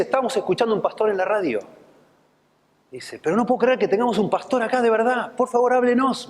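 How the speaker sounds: high, amazed and pleading; amazed old man. Retold or imitated. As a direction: beg the pastor to speak, astonished.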